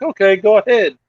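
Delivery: quoting the border agent, pitched high and loud; a breezy high "go ahead" from the agent; mimicked agent. Agent waving them through.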